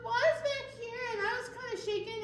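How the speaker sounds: high, squeaky little tiger puppet; cheerful puppet voice. Pretend. Host voicing Tiger the puppet for the kids. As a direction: play the eager puppet friend.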